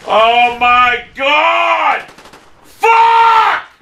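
long drawn-out exasperated yell; real gamer rage; furious shout. Real, not acted. Player losing it at game.

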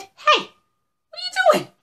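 very high, loud, chattering squirrel; high-pitched fussy little critter; indignant squirrel. Acted. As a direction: scold the intruder in a squeaky squirrel voice. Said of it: "And Mister Squirrel said".

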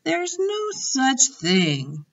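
put-on high, slow girl's voice, doubting; a young girl refusing to believe it; Sophie. Sophie telling the giant, high and slow with doubt.

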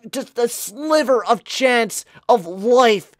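screaming high and loud in disbelief; ecstatic shouting. Real, not acted. Gamer screaming as Johansson ties the game late. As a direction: shriek with joy at the last-second goal. Tying goal in final seconds.

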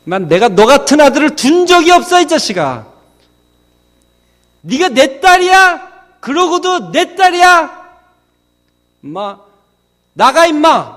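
high, loud outraged shouting; angry dad. Acted. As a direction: play a father disowning his children, yelling high. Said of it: "아빠 화나면 뭐라 그래요?". (화난 아빠처럼 높고 큰 소리로 호통)

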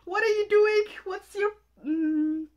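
high, loud squeals at the screen; worked up fan yelling; excited yelling. Real, not acted. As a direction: shout at him in delight. Yelling at the video.

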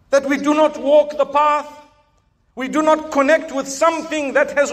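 loud, high rousing sermon delivery; fervent preacher building to a cry; impassioned preaching. Real, not acted. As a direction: rouse the congregation, pushing the voice up. Preacher raising voice at congregation.

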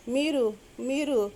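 slow, halting repeat of the words; stammering. A narrator's reading. Girl flustered on the phone with Siddhartha's father, stammering. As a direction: stumble over the words, caught off guard. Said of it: "తడబడుతూ అంది".

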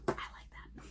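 quick whispered aside to yourself. Real, not acted. Vlogger to herself about a shade she likes, whispered. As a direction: whisper it, barely voiced.

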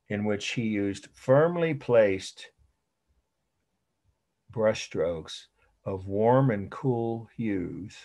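slow repeat with long pauses; drawn-out, deliberate delivery for note-takers; slow dictation. Shown as a demonstration. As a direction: dictate the definition phrase by phrase. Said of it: "I'll say it again".

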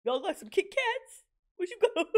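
loud, playful catcalling Titan; joking Titan voice. Pretend. Reactor voicing a Titan for laughs, loud catcall. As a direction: call out like a cheeky Titan. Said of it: "This Titan said".

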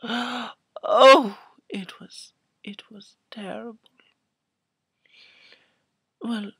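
ordinary delivery

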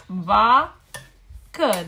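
"vacă" as VA, pause, CĂ; two separate beats on "vacă"; split into syllables. Shown as a demonstration. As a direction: break "vacă" after va. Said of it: "silabă la început".

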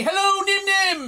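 calling out. Real, not acted. Calling hello to a young fan, bright and high. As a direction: greet her like she's across the room.

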